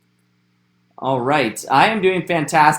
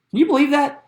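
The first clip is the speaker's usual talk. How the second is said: high, amazed question; 11 semitones higher, faster than usual. Real, not acted. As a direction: marvel at it openly. Host amazed by tree ages.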